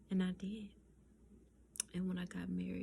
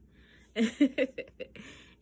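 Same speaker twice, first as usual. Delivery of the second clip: bursting loud laugh; 4 semitones higher, 12 dB louder than usual. Real, not acted. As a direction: let a big laugh burst out. Laughing while recalling the proposal.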